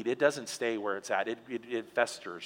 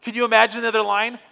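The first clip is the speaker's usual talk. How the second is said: ordinary talk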